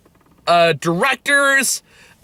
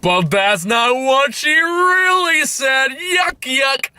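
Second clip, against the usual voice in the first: high, slow sneering mock narrator; mocking movie voice; 6 semitones higher, much slower than usual. Mocking the film's narrator, high and sneering. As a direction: ham up the movie's narrator with disgust. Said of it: "and then the movie goes".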